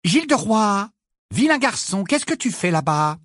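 calling out. A narrator's reading. Motherly healer calls her wandering patient back, gently scolding. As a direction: call down a corridor to a naughty child. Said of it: "une voix appela".

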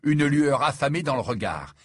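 ordinary delivery

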